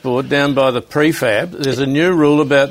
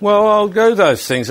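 higher, drawn-out protest; defensive protest; 7 semitones higher, 3 dB louder, slower than usual. Acted. Head prefect defending his promises to the teacher, defensive protest. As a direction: protest slowly, voice pitched up.

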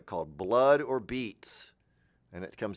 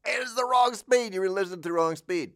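squeaky sped-up record voice, much higher; record at the wrong speed; 10 semitones higher, 4 dB louder than usual. Retold or imitated. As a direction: sound like a record spun too fast. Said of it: "I was playing it at the wrong speed".

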